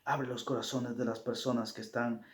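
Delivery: soft, humble closing prayer. Acted. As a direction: pray quietly to the Father.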